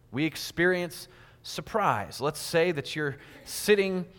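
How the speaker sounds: ordinary talk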